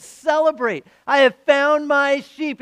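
high, loud call to celebrate; joyful proclamation. Acted. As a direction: announce the good news to the whole town, slowing at the end. Shepherd announces found sheep on return.

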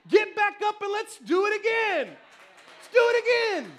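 loud, high preaching climax; drawn-out, impassioned exhortation; rallying shout. Real, not acted. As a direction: drive it home at full force.

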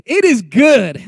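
loud, convinced "It is good."; said like it's good. Shown as a demonstration. Preacher showing the congregation how to say it, loud and convinced. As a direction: say "It is good." like you mean it. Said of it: "you gotta say it like it's good".

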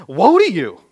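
a loud, high cry of warning. Real, not acted. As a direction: declare the woe aloud. Preacher's warning woe, loud.

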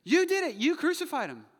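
loud, high-pitched indictment; accusing shout. Acted. Peter blames the crowd for the crucifixion, shouted accusation. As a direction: hurl the blame at them at full force.

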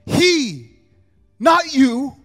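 preaching at full force. Acted. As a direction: drive the promise home to the church. Preaching climax.

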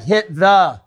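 slightly exaggerated director calling out a note; Kevin. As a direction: lightly mimic a director's insistent line note. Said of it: "Kevin would be like".

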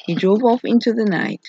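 usual speaking voice

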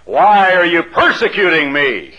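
loud, drawn-out voice from heaven; heavenly voice. Acted. God calling to Paul on the ground, loud rebuke. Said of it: "He hears a voice".

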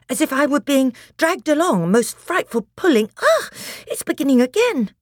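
high, loud breathless alarm. Acted. Edmund feeling the frightful pulling return, breathless alarm. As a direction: gasp it out, rising to alarm. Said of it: "said Edmund in a breathless voice".

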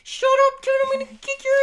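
imitating an angry kid's squealing shout; mock tantrum, voice shooting way up; high-pitched fury. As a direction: screech it very high, words tumbling out fast. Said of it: "his voice would get higher, and he would get so pissed off".

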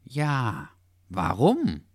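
drawn-out, wondering question; pondering. A narrator's reading. Dachshund pondering why dogs may not eat it, musing. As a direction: mull it over aloud. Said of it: "überlegte Dackel".

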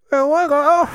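high-pitched, badly faked British accent; attempted British accent. Shown as a demonstration. Host attempting a British accent for the co-host, badly faked British. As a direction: have a struggling go at sounding British. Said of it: "attempting a British accent".

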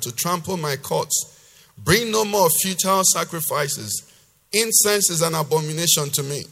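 loud, emphatic preacher's reading; forceful scripture reading. Acted. Preacher reading God's rebuke to the congregation, loud and emphatic. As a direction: declaim God's rebuke with hard-hit key words.

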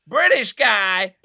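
high, slow American shout; mock American accent. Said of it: "a Scottish guy doing the American accent".

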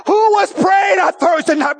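high, loud, slow cry of a shout. Real, not acted. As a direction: cry it out to the whole church. Repeated question, crying shout.

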